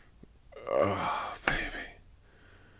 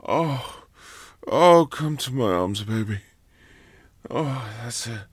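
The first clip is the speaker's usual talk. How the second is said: tender afterglow; 4 semitones higher, 6 dB louder than usual. Acted. Afterglow, calming down.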